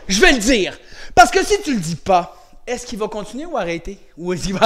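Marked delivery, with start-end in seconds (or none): 0.1-0.8 firm demo shout. Shown as a demonstration. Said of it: "tu lui dis".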